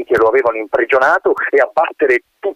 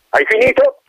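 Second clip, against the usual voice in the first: colonel asking. Acted. Colonel asks the wind.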